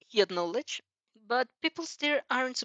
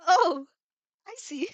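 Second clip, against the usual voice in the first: flustered; 9 semitones higher, 6 dB louder, slower than usual. Acted.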